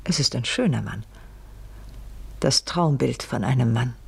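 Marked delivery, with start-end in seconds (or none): none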